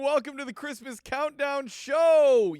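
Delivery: high-pitched, drawn-out holiday show welcome; stretched-out, high announcer greeting. Acted. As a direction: announce it like a big festive show opener. Show host opening announcement.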